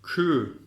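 light "kü" with fronted ü; thin "kü". Shown as a demonstration. Teacher models the thin kef letter, light "kü". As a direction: keep the k thin in "kü". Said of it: "ince harfimiz".

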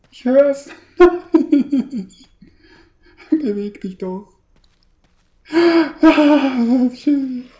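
high, loud panicked shouting; being chased. Real, not acted. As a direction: shriek while fleeing in terror. Zombie chasing, panicked shouts.

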